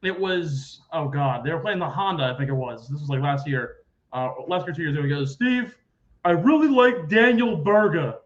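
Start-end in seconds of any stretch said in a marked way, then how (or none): 5.3-8.1 higher, louder mock confident tip; imitating Barry. Barry tipping Steve on Daniel Berger, imitated confident voice. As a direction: pitch the pick like a sure thing.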